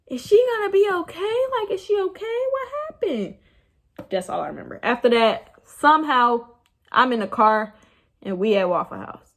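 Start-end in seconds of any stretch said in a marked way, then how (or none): 0.1-3.4 much higher voice imitating a concerned desk clerk; imitated front desk lady. Front desk lady asking about a drunk guest, high-voiced imitation. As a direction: play the concerned receptionist, pitched way up. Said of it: "hearing the front desk lady like".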